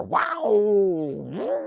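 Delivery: one high held mouth-guitar wow. Retold or imitated. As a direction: hold a single high wailing guitar note with the voice. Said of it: "That's how his guitar sounds".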